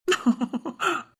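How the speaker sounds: quiet stifled chuckle; soft laugh. Real, not acted. Husband laughing quietly at the joke about his wife, stifled chuckle. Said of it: "சிரிக்காதடா".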